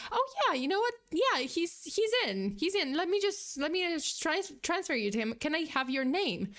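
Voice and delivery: an overly chirpy office assistant who is keen to put the call through; excited secretary. Retold or imitated. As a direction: gush like a receptionist who is thrilled to help. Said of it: "very excited".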